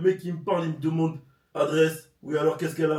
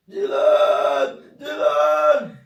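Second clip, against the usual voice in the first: piercing high yell, much louder; screaming his name; 11 semitones higher, 11 dB louder, much slower than usual. Retold or imitated. Woman in labour screams for her partner from the shower, shrill yell.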